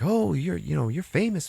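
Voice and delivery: high-pitched impressed recognition; mock starstruck. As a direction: imitate fans who just spotted someone famous. Group recognizes a famous man.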